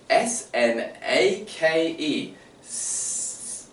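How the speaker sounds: "S N A K E" spelled slowly by letter names, high. Shown as a demonstration. Teacher mocking reading by letter names. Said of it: "You don't say".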